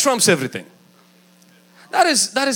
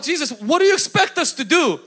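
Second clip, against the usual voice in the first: exasperated protest; 6 semitones higher than usual. Acted. Acts out disciples protesting to Jesus.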